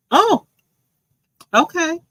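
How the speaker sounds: high, loud surprised exclamation. Real, not acted. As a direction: caught off guard, voice jumps up. Reacts to caller's comment.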